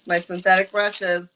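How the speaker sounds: normal talking voice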